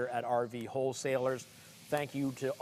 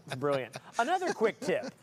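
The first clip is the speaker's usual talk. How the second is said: ordinary talk, nothing marked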